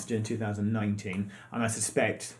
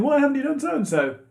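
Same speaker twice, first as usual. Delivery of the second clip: mock whiny question; 13 semitones higher, 5 dB louder than usual. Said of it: "for everyone saying".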